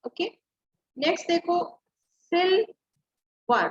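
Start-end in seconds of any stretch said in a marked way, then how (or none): 2.3-3.7 silver in two slow, separate beats, "सिल", pause, "वर"; split syllables. Shown as a demonstration. Teacher sounding out syllables for pupils, silver slowly split in two. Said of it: "टू times आपकी sound आई".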